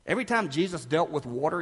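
normal talking voice

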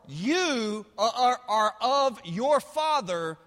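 loud, weighty pulpit declamation; forceful, deliberate denunciation; preaching scripture as an accusation. Acted. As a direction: land each word as a charge. Climax of the scripture reading.

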